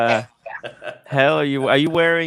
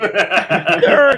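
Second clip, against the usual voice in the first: laughing call; 6 semitones higher, 5 dB louder than usual. Real, not acted. Greeting friend, laughing.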